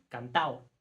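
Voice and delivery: "Cantao" with the D of "cantado" left out; dropped D. Shown as a demonstration. Teacher shows students D elision: "Cantao" without the D. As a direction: say "Cantao", no D between a and o. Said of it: "la D en cantado puede desaparecer".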